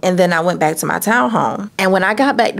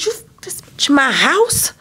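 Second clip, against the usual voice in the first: retold angry yell; 6 semitones higher than usual. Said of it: "I said".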